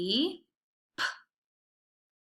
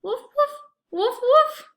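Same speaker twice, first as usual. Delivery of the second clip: high playful woof woof; dog barking; 8 dB louder than usual. Pretend. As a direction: bark like a puppy for kids. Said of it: "What sound does a dog make?".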